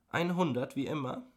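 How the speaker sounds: high-pitched, pressing question; insistent. A narrator's reading. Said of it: "beharrte sie".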